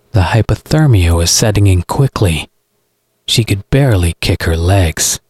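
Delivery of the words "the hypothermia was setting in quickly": ordinary delivery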